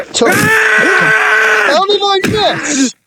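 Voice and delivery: high, slow, drawn-out yell; disbelieving shout. Real, not acted. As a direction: wail at the missed shot. Repeated outburst after missing.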